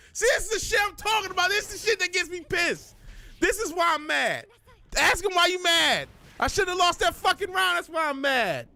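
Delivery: fast, high, heated rant at the game; real frustration spilling out; angry rant. Real, not acted. Ranting after losing the round.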